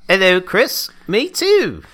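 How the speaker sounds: put-on high, loud British accent; mock British accent. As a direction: ham up a British greeting. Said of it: "we had British accents".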